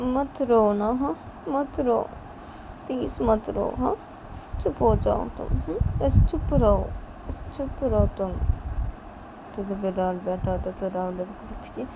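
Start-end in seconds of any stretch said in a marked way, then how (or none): 0.0-8.3 put-on gently coaxing a crying baby; soothing baby.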